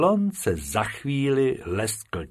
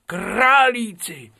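"králíci" much higher, louder and slower; delighted králíci; 11 semitones higher, 6 dB louder, much slower than usual. Acted. Bobek to Bob at the pens, delighted. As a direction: cry out "králíci" with joy. Repeated word, bigger.

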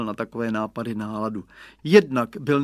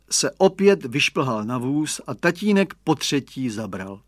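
plain reading voice

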